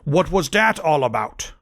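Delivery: lightly acted angry demand; mock indignant question at modest volume; angry shout. A narrator's reading. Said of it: "they both shouted angrily".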